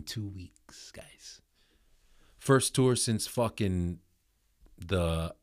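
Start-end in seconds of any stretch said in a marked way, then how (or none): 0.0-1.4 slow hushed whisper of good news; excited whisper. Real, not acted. Host telling listeners the tour starts soon, excited whisper. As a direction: whisper it like a thrilling secret.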